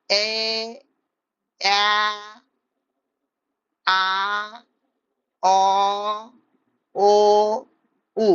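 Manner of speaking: এ, অ্যা, আ, অ, ও, উ as isolated held vowels; vowel drill. Shown as a demonstration. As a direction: recite each vowel alone, steady, with long gaps. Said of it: "তারপর কি বলো". (স্বরধ্বনি একটা একটা করে টেনে বলা)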